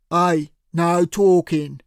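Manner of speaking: slow, loud thug bark; barking tough guy. A narrator's reading. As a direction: bark it like a heavy. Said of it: "Cyril barked".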